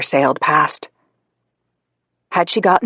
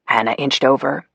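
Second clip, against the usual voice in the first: plain reading voice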